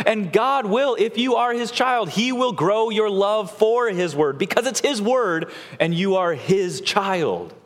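high, impassioned exhortation; fervent preaching. Real, not acted. Preacher urging believers to grow in the word, fervent. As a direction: urge them on from the pulpit.

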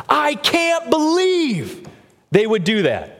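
high, drawn-out shocked disbelief; scandalised gossip. Retold or imitated. Preacher mimicking judgmental Christians, high and scandalised. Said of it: "they'll say things like".

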